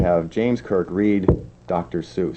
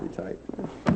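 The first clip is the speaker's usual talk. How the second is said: whisper; 12 dB quieter, much slower than usual. Real, not acted. Host repeats guest.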